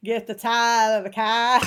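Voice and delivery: laughing, loud mock Boston accent. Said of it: "in a Boston accent".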